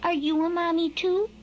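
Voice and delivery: high, innocent chick question; small high baby-bird voice. A narrator's reading. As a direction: ask high with a rising end, like a hatchling. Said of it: "and asks".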